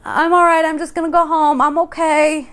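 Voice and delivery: high, reassuring self-quote; brushing it off. Retold or imitated. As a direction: wave off concern, dazed. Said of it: "And I'm like".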